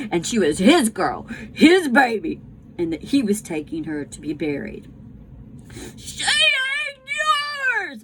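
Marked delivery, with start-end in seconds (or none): 5.9-8.0 much higher, slow, drawn-out wail; crying scream. Acted. Bryce screams at the father taking Sarah Ruth away, crying scream. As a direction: scream it through sobs. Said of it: "Bryce screamed".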